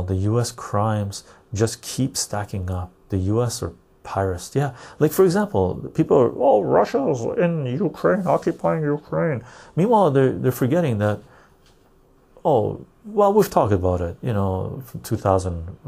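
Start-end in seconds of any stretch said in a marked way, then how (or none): 6.3-9.4 mocking parrot. Mocking what people say.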